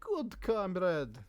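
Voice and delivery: slow approving comrade in a put-on Slovenian accent. Merchant praising a friend, in a put-on Slovenian accent. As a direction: drawl it out in a mock Slovenian accent. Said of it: "I can do a Slovenian accent".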